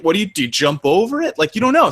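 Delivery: normal talking voice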